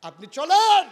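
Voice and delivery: loud call soaring on চলেন; rousing shout. Acted. Umar urging the Prophet to march, rousing shout. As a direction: cry it out as a summons.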